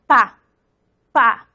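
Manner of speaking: isolated bilabial "Pa" demo, lips closing; bilabial pa sound. Shown as a demonstration. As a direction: say "Pa" with both lips pressed then popped open. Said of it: "la P es bilabial". (pronunciación aislada de "Pa", con ambos labios)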